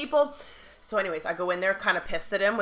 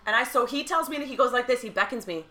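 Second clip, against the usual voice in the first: ordinary delivery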